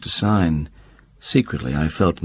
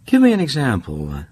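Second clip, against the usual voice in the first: ordinary delivery, nothing marked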